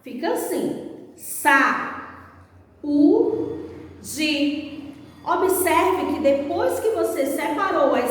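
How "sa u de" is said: sa, u, de as separate beats with pauses; syllable by syllable. Shown as a demonstration. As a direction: break it into sa... u... de. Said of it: "Vamos separar as sílabas dessa palavra?".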